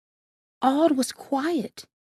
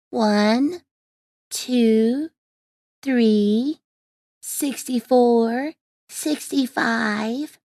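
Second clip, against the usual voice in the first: slow, drawn-out counting; bored counting; much slower than usual. A narrator's reading. Peter counts sheep one by one, drawling in boredom. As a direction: stretch each number out, tediously.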